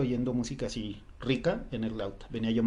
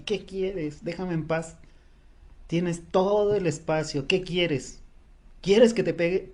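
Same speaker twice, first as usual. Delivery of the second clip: higher-pitched mock yell at another driver; retold angry yelling; 5 semitones higher, slower than usual. Driver yelling at a woman harassing her car, retold angry yell. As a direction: re-enact yelling in exasperation, pitch raised. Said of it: "le empecé a gritar, vociferando así".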